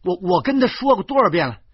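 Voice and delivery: stammering rage rising to high shouting; furious. Acted. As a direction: rant with indignant, stuttering outrage. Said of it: "我怒不可遏地指着豆豆说".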